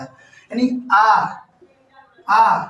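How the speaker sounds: आ held long and open; drawn-out back vowel. Shown as a demonstration. Teacher slowly modelling the back vowel आ. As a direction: stretch आ slowly from the back of the mouth. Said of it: "जीभ का पिछला वाला हिस्सा".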